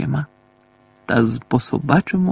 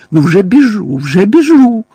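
higher, louder hurried cry; panicked yelling; 9 semitones higher, 8 dB louder than usual. A narrator's reading. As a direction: yelp it as you flee. Mundungus fleeing the beating.

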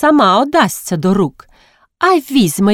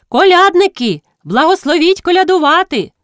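high, louder carollers' cry; calling out; 11 semitones higher, 4 dB louder than usual. A narrator's reading. Carollers answering the householder through the window, calling out. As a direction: announce yourselves in a high, ringing call.

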